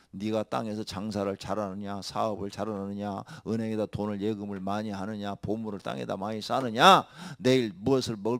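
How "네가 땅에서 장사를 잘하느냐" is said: ordinary delivery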